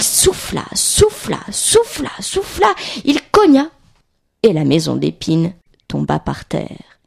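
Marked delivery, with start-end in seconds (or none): 0.0-3.7 acted-out huffing. A narrator's reading. Narrator shows the wolf blowing and banging, emphatic. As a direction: huff each verb, then hit the last.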